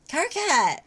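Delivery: high panicked cry of a name. Acted. Napada crying out in panic at the prophet. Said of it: "cried Napada, panicked".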